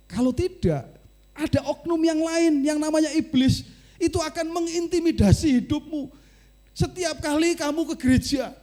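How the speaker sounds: high, loud preacher's warning; fiery preaching. Acted. Preacher warning the congregation about the devil, loud and high.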